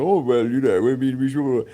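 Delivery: a hint of a dithering toff; lightly voiced posh hesitation; posh person. The posh panel guest fumbling an answer, in a light toff voice. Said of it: "the posh person's like".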